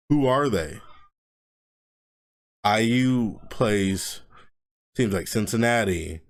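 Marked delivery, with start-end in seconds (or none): none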